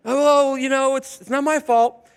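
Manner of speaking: high, loud mock excuse-maker; hammed-up whine of not my fault; whiny excuse. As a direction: shrug off the blame.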